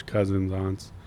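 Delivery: ordinary delivery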